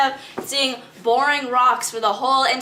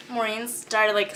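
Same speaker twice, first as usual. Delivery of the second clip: normal talking voice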